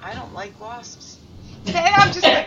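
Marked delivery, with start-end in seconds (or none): 0.0-1.2 flat, quiet, slow deadpan. Retold or imitated. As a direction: say it dead straight to camera. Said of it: "dead looks at the camera and says".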